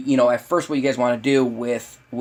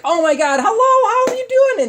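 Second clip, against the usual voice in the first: very high, loud gushing squeal; hammed-up, high-pitched excited hello; over-excited greeting; 5 dB louder than usual. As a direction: gush at the dog like an overexcited owner. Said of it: "be very excited and be like".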